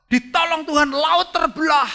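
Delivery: usual speaking voice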